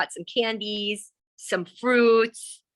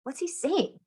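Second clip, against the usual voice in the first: confused; 6 semitones higher, 4 dB quieter than usual. Acted. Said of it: "the boys were so confused".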